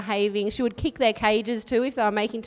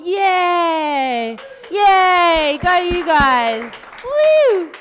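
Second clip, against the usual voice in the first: loud high yay and woo; loud high whoops; cheering; 7 semitones higher, 8 dB louder, much slower than usual. Real, not acted. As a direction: cheer the crowd on. Cheering audience moving up.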